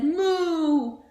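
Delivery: long loud mooing; cow moo. Acted. Teacher reading to class, a loud long moo. Teacher reads animal sounds.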